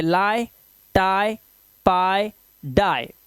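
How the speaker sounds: "lie, tie, pie, die" each with an eye vowel. Shown as a demonstration. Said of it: "आई से पढ़ेंगे".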